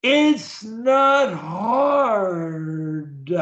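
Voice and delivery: very slow, stretched-out syllables; careful pronunciation. Shown as a demonstration. Coach models lines for a stutterer to repeat, careful drawn-out pronunciation. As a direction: draw out every word with full articulation. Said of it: "pronunciation of every word".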